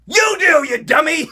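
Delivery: high, loud playful yell; teasing shout. Acted. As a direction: shout it at him in mock scolding. Teasing the fan who asked.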